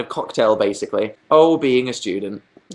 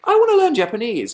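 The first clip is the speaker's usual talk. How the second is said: eager thought; 11 semitones higher, 3 dB louder, faster than usual. Retold or imitated. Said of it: "and I think".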